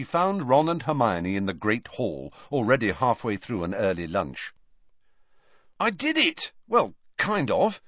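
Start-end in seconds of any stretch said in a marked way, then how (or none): 5.8-7.8 high, bursting with pride; enthusiastic. A narrator's reading. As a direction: announce your small win eagerly. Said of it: "Ron told Harry enthusiastically".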